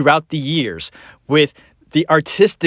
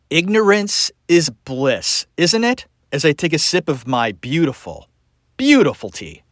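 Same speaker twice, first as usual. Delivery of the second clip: ordinary delivery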